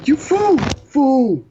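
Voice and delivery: high, loud mock outburst; mock yelling. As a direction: shout it in playful outrage.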